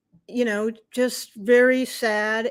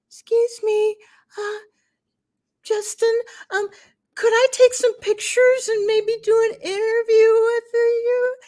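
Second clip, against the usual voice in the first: a lightly played timid request, much higher; timid asking; 12 semitones higher, 6 dB louder than usual. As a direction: ask shyly in a raised, small voice. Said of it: "I was very timid".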